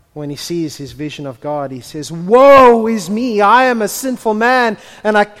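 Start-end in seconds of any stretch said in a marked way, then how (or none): 2.1-5.3 anguished lament. Acted. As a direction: wail it as a man undone before God. Isaiah despairing before God.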